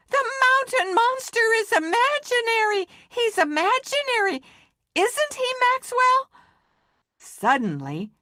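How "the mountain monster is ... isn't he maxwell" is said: much higher, fast panicked cry; frightened scream. Acted. Scared friends pleading with Maxwell, high panicked cry. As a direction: squeal it out, begging to be told it's not real. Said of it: "both screamed".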